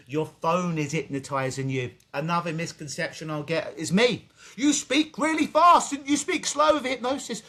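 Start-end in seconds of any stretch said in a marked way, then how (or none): 4.6-7.4 high, loud imitation of a critic; mock complainer. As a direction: parrot the complaint in a pitched-up voice. Mimics people's misconception about him.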